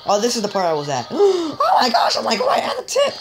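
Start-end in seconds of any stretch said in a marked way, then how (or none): 1.1-1.6 sharp high gasp; quick high-pitched gasp of alarm; startled gasp. Real, not acted. Startled by game chase. 1.6-3.1 very high, panicked squeal; scared squeal. Real, not acted. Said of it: "I got so scared".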